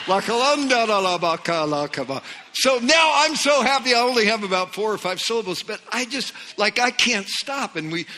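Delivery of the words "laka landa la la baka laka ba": loud, flowing mock babble, pitched up; tongues taking off. New believer's tongues suddenly start going, loud flowing imitation. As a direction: let the syllables burst out in a run. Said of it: "pretty soon it started going".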